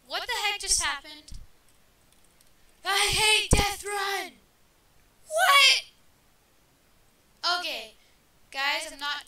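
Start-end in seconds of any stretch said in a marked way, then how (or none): 2.8-4.4 slow, loud, fed-up shout; frustrated yell. Real, not acted. Frustrated at losing the game. 5.3-5.8 high, loud startled yell; shocked shout. Real, not acted. Shocked at in-game death.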